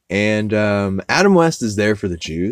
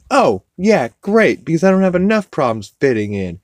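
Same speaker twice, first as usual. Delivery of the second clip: high, louder mock sarcasm; sarcastic kid; 9 semitones higher, 5 dB louder than usual. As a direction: roll your eyes through it. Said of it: "he's like".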